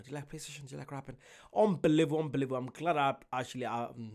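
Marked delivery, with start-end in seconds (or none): 0.0-1.2 softly imitated flirting line; soft, casual mimicry of a man making a move; mock chat-up.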